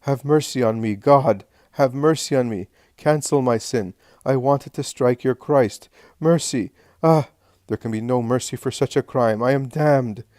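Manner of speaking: high, loud plea for mercy; desperate shouting. A narrator's reading. Sinner begging God for mercy, desperate shouting. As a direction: cry out as one who is damned. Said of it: "covering his face and shouting".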